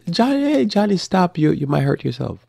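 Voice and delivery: high-pitched mock parental warning; parent calling out. Parent warning Johnny as he runs, high and ineffectual. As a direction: imitate a parent calling after a child. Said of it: "And you say".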